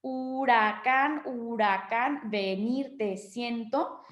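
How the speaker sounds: slow, syllable by syllable; drawn-out scansion of the verse; syllable counting. Shown as a demonstration. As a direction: spell out each syllable evenly, Huracán as hu-ra-cán. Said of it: "la división silábica".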